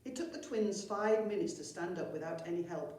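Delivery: ordinary delivery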